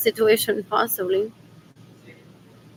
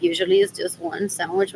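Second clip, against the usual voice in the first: usual speaking voice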